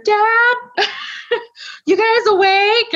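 high, loud call down the hallway. Retold or imitated. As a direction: call out to wake the house. Calling out to family at night.

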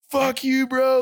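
high, raw yell of hurt anger. Retold or imitated. To his old boss, shouting in hurt anger. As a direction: yell it like you've been wounded. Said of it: "Like, super hurt".

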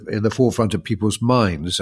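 ordinary talk

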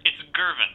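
Gervin said firmly with a hard G; stress on Gervin. Acted. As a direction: correct the name: Gervin, hard G. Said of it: "gonna stop you there".

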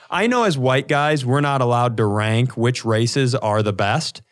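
usual speaking voice

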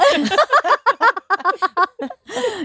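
high burst of laughter. Real, not acted. Laughs at own story.

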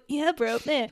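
lightly imitated sarcastic thumbs-up. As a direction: toss off a sarcastic bro reply. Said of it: "like, a sarcastic thumbs up".

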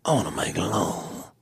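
put-on British accent, seductive; soft British. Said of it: "It's very different".

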